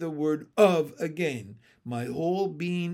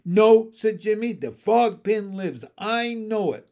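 loud, higher, insistent; loudly insisting; defiant young frog; 5 semitones higher, 8 dB louder than usual. A narrator's reading. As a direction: shout it in stubborn belief. Jimmy protests loudly in story.

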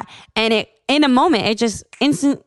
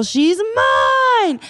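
high, drawn-out possessed cry; eerie high-pitched voice speaking through her; possessing voice; 13 semitones higher than usual. Retold or imitated. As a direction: wail it high, stretching the last word. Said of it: "out of her mouth".

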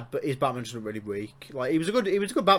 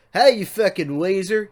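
louder, higher, slow southern drawl; southern accent; 6 semitones higher, 7 dB louder than usual. Shown as a demonstration. Host showing co-host how an insult sounds, southern accent. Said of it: "now that it's in a southern accent".

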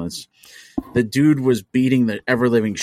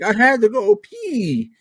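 put-on much higher, slow little-kid voice; little son; 12 semitones higher, 3 dB louder, slower than usual. Son caught peeing on the car: high, slow little-kid voice. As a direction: whine it like a four-year-old.

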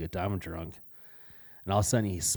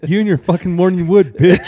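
loud, high mock outrage; mock outraged yell; 10 semitones higher, 16 dB louder than usual. Mock complaint about roommate.

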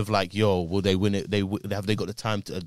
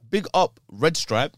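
put-on Jamaican accent; 7 semitones higher, 6 dB louder, slower than usual. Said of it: "rate my Jamaican accent out of ten".

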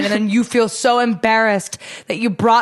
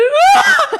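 high, loud shriek imitating a fight; mock scream; 6 dB louder than usual. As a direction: let out an exaggerated screaming-match yell. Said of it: "turned into a screaming match".

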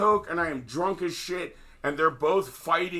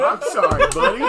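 laughing apology, higher and louder; 8 semitones higher, 9 dB louder than usual. Real, not acted. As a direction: say it through real laughter.

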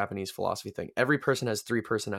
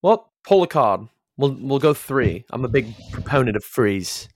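louder East London, th as f; East London accent; 3 semitones higher, 7 dB louder than usual. Pretend. Guest asking for three cards, in East London accent. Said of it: "you can just be East".

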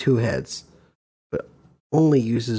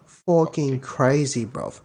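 put-on drawn-out London slang accent; British accent; slower than usual. Reacting to the dictionary entry, slow British accent. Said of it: "Give me a fucking British accent".

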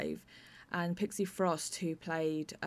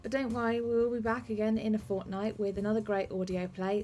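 bright, higher host sign-off over music; podcast outro; 4 semitones higher than usual. Acted. Host signing off the episode to listeners, in a bright announcer voice. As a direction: wrap up the show like a radio host.

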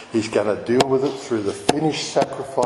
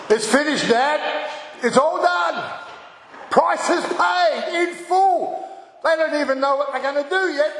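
much higher, jubilant and laughing through it; triumphant cry; 11 semitones higher than usual. Acted. Preacher voicing Jesus declaring victory, high jubilant laughing cry. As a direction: announce victory with joy. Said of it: "he cries out".

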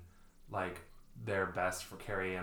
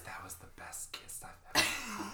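hushed, much higher mock-swoon; mock Trinity; 3 dB quieter than usual. Joking as Trinity after kissing Neo, hushed mock swoon. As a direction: gush it in a high, whispery voice.

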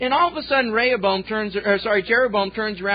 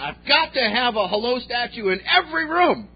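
mafia boss insisting, louder and higher; adamant; 5 semitones higher, 5 dB louder than usual. Retold or imitated. Boss insisting to the contractor, adamant and loud. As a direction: mafia boss demanding it, won't budge. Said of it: "this guy's just adamant".

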